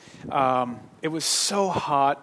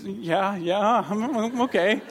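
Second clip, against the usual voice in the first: put-on little girl's voice; 4 semitones higher, slower than usual. Daughter fighting back tears.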